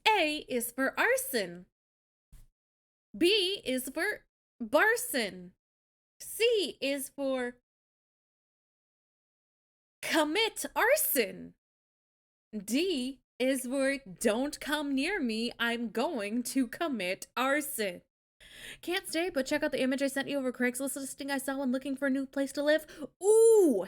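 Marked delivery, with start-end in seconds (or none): 0.1-18.0 slow. Acted. Slow arson alphabet chant. 23.2-23.8 excited. Real, not acted. Excited exclaim.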